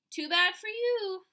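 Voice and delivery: high, loud, slow gloating; taunting. Acted. Sheila gloating at the boys from the rock, high and loud. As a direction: rub it in their faces.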